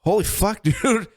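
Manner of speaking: high, laughing holy fuck; high-pitched laughing disbelief; amazed. Retold or imitated. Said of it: "I was like".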